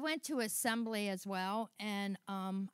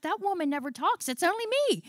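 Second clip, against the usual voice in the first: mock exclamation; 8 semitones higher, 3 dB louder, faster than usual. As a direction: pitch climbing high into a comic punchline. Joking quote of herself about therapy.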